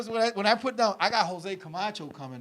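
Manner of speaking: normal talking voice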